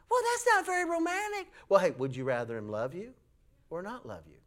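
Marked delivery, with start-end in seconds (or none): 0.1-1.5 high, disappointed wife; mock wife voice. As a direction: play the let-down wife. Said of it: "wife answers preacher".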